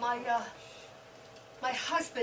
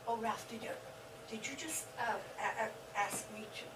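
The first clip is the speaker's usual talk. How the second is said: much softer, faltering disbelief; hushed stunned; 4 semitones lower, 12 dB quieter than usual. Acted. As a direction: barely voice it, stumbling over the words. Stunned whisper-soft question.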